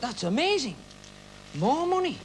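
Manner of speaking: rising excited wonder; amazed. Retold or imitated. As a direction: marvel at the money. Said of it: "I thought".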